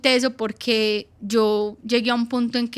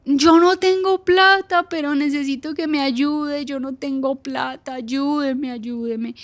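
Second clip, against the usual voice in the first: starts as a high, loud, slow cry, then fades to a pleading murmur; retold desperate yell; 4 semitones higher, 3 dB louder, slower than usual. Mother on the phone to the clinic, no money, desperate yell fading to pleading. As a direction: cry out for help, then run out of strength. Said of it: "Y yo empecé a gritar".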